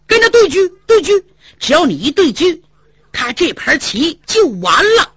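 high, loud, hurried urging; anxious kibitzing. Acted. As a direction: hurry him to swap chariots. Hu Dahai urging the player anxiously.

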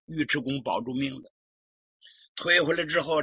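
usual speaking voice

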